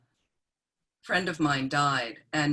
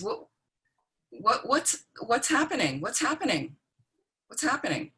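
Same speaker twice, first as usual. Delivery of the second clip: bewildered, pleading repeats, pitched up; a dying woman waking lost and frightened; very confused; 6 semitones higher than usual. Retold or imitated. Dying friend waking, asking what's happening, confused and pitched up. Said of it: "very confused".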